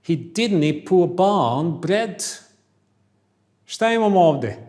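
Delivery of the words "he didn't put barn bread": very slow, loud Scottish accent, dropped t's; Scottish glottal stops, drawn out. Shown as a demonstration. Teacher reads the Scottish sentence to class in Scottish accent. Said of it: "Scottish English".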